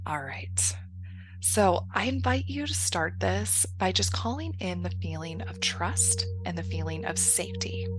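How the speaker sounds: soft, soothing meditation voice with long pauses; guided meditation. Acted. Coach settling listeners into breath and trust, guided meditation. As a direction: ease them gently into calm, quietly and unhurried.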